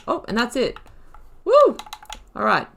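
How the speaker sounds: ordinary talk, nothing marked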